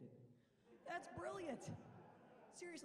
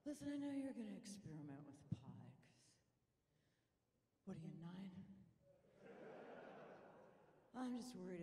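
hippie mom voice; 4 semitones lower, 7 dB quieter than usual. Retold or imitated. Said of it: "She's like".

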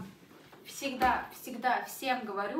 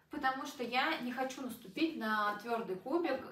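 ordinary talk

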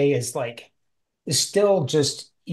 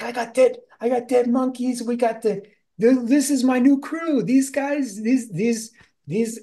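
excited Jala; 10 semitones higher than usual. Retold or imitated. Jala calling everybody over, high and eager. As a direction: burst in calling the whole crew over. Jala shows off dead monkeys.

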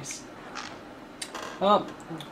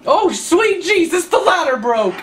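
high, loud yell of dismay; shocked outburst; 12 semitones higher, 6 dB louder, slower than usual. Real, not acted. Gamer reacting to the broken ladder, shocked yell. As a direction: cry out, dragging the words.

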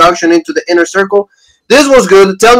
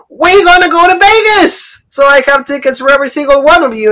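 mock MJF announcement; 10 semitones higher than usual. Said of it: "he said".

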